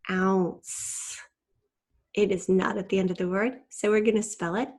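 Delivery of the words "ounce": OUNCE with the ow sounded out and a lingering s. Shown as a demonstration. Teacher showing students a fun ow sound, sounding out OUNCE. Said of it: "one of our fun ow sounds".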